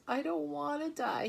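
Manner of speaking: high moaning plea, close to tears; moaning pig. Acted. Wilbur moans to Charlotte about dying. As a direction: whimper it like a frightened piglet. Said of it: "he moaned".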